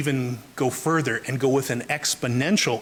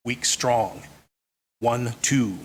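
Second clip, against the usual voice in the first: paired beats "weak, strong", "one, two" with a pause between; heartbeat rhythm; 3 semitones lower, slower than usual. Shown as a demonstration. Lecturer relating meter to the heartbeat, pulsed "weak, strong, one, two". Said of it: "the beating of the human heart".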